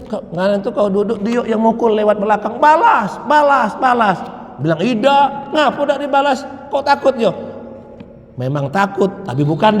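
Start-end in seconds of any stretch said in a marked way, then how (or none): 2.6-4.2 higher, louder mock cry of hit back; mock urging shout. 4.8-7.3 higher teasing taunt of a friend. Retold or imitated.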